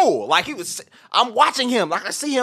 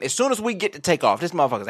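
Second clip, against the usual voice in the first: ordinary talk, nothing marked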